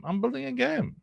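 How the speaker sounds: very high, held squeaky pitch; mock-indignant high-pitched reply. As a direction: squeal the answer in a high, level tone. Answers the echoed question, mock indignant.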